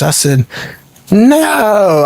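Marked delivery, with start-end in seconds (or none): 1.1-2.0 drawn-out, raised no of disbelief. Retold or imitated. Son hearing of dad's endorsement, retold disbelief. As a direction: react as if refusing to believe it. Hears shocking news about his dad.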